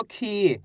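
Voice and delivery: खी with a long, falling ee; drawn-out vowel. Shown as a demonstration. Teacher drilling the long matra, खी drawn out. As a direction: stretch the ee in खी. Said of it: "खींच खींच के उच्चारण".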